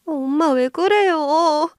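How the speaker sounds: high, drawn-out plea; alarmed daughter. A narrator's reading. Daughter begs Mom to explain herself, alarmed high whine. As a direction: stretch it out slowly in alarm.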